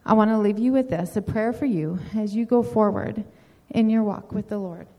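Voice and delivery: usual speaking voice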